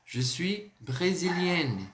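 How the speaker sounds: slow, drawn-out "brésilienne" with a sounded N ending; feminine pronunciation. Shown as a demonstration. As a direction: model "brésilienne" slowly for students, landing on the final N. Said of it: "Vai ficar assim a pronúncia".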